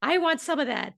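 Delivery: high, rushed, gushing excitement; eager fan. Pretend. Podcast listener begging to book Amy, high and gushing. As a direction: gush like a fan who wants in.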